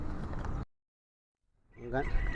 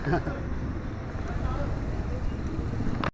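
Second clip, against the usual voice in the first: breathy laugh; 11 semitones higher, 6 dB quieter than usual. Real, not acted. Laughing at posh barangay.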